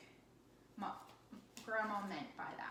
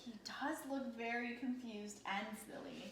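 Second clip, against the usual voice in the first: ordinary talk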